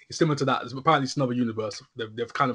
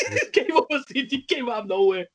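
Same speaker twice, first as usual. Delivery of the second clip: laughing; 14 semitones higher, 5 dB louder than usual. Real, not acted.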